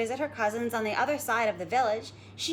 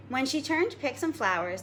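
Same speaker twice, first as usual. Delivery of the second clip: ordinary delivery, nothing marked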